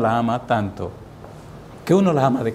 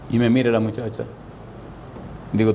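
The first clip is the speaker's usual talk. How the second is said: ordinary talk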